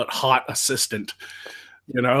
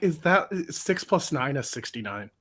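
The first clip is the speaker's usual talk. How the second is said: dumb assistant; 2 semitones lower, 5 dB quieter than usual. Retold or imitated. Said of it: "she's just like".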